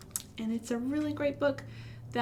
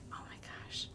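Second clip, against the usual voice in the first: awed breathless whisper; 14 dB quieter, faster than usual. Acted. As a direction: gasp it under your breath. Flowers getting bigger.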